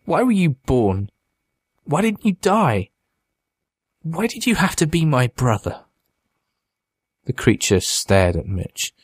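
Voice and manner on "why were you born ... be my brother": high-pitched, tearful reproach; desperate, grief-stricken questions; anguished pleading. A narrator's reading. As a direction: cry out each question to a brother, pitch raised. Mitch sobbing at his brother.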